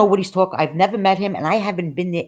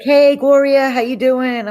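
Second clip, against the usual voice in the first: high, loud friendly hello across the store; cheery greeting; 7 semitones higher, 5 dB louder, slower than usual. Retold or imitated. Store staff greeting regular customer Gloria, high loud cheery hello. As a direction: call out her name brightly.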